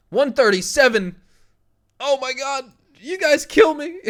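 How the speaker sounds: high, loud amazed outburst; excited shouting. Real, not acted. Streamer seeing the likes jump, excited shout. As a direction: yell in delighted disbelief.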